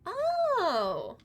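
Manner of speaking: ordinary talk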